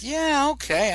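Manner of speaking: high-pitched, comic yes; eager mock agreement. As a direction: leap up high as you agree. Retold agreeing to the gal's request.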